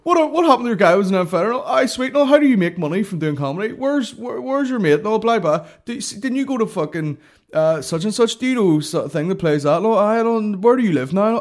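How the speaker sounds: high, loud mock interrogation; nosy fan. Fan fires every question at the comic, nosy and overexcited. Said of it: "get all their questions out".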